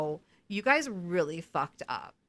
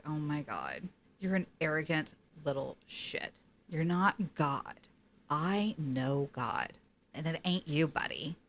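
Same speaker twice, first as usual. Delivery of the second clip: mock scornful Death; 4 semitones lower, 4 dB quieter than usual. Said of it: "He is just like".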